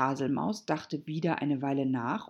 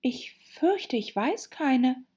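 put-on hesitant, high girl's voice; slow, higher, uneasy reply; rather worried; 10 semitones higher, 3 dB louder, slower than usual. A narrator's reading. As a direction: say it with some alarm. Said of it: "von diesem Vorschlag ziemlich beunruhigt".

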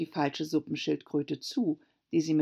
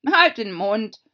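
loud, higher Gryphon command; sharp order; 5 semitones higher, 10 dB louder than usual. Acted. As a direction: shut the girl up loudly. Gryphon silences Alice. (lauter, scharfer Befehl)

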